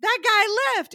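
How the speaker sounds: high, loud retold gossip; imitating a scandalized food runner; scandalized announcement. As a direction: blurt out the shocking news. Food runner announces the walkout, acted.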